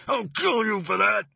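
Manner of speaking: furious threat. Acted. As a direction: spit out a murderous threat. Winded man threatens from ground.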